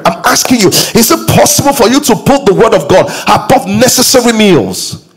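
fired-up preacher urging the congregation; preaching at full force. Acted. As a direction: pitch it up and push, like a pulpit climax.

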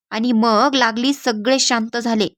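plain reading voice

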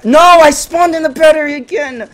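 high, loud cry of frustration; real exasperated outburst; dismayed yell. Real, not acted. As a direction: wail loudly at bad luck. Dismay at bad spawn.

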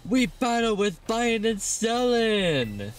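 high, slow theatrical read; dramatic announcer. Acted. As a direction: declaim it like a grand showman. Reading the auction dialogue.

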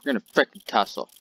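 normal talking voice

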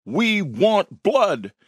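high, slow crowd chant; crowd shout. A narrator's reading. Party guests egg on the fight, shouting high. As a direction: call for it like a rowdy mob. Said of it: "The others shouted and clapped".

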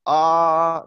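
long open "Ah"; drawn-out southern "Ah"; southern English A. Shown as a demonstration. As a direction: say "Ah" long and open, London style. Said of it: "I pronounce it like this".

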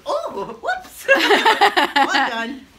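high squealing laugh through the words; laughing surprise. Real, not acted. Owner spat on by alpaca, squealing with laughter. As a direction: burst out laughing as you get spat on.

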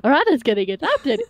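overwhelmed happiness. Acted. Sammy overjoyed that Arata gets adopted, giddy excitement. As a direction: burst out with delight, close to laughing. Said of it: "it got overwhelmed by the fact".

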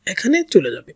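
usual speaking voice

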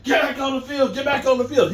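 high, insistent mock scream; imitated screaming. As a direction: shout it urgently, imitating a player yelling at coaches. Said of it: "and he's screaming".

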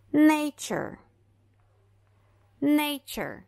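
nature with ch sound; nay-cher, t as ch; English model word. Shown as a demonstration. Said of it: "esse T vai ser pronunciado como C H".

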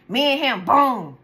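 usual speaking voice

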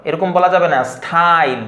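ordinary delivery

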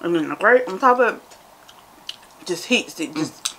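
eating and describing taste; talking while chewing. Real, not acted. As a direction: mouth full of candy. Seen 1: Right speaker's jaw and lips keep chewing between words; candy bowl near.

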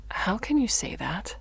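restrained, wounded question; contained, stung reply; hurt disbelief. Acted. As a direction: ask it hurt, at everyday volume. First hurt reply to accusation.

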